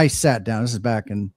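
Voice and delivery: normal talking voice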